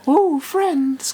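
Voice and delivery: very high falsetto tease; falsetto ooh. Retold or imitated. Quoting the Friends episode line, high falsetto tease. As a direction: squeal it like a teasing schoolkid. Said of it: "that episode with the".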